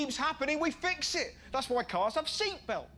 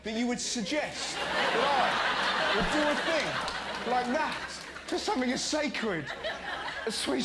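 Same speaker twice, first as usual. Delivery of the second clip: hammed-up indignant denial; 9 dB louder than usual. Acted liar's overblown denial.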